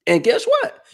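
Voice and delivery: high, fast and hyped; excited. Real, not acted. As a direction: rattle it off all fired up.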